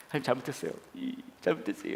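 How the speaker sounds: soft, whimpering confession, re-enacted; imitated repentant prayer. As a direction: plead softly as if praying while driving. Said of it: "막 회개하면서 막 운전하고". (울먹이듯 회개하는 흉내)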